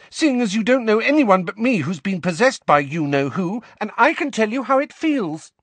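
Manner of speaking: high, loud indignant tirade; angry. A narrator's reading. Ginny reminds Harry she was possessed, angry. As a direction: drive it home, angry and high-pitched. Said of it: "said Ginny angrily".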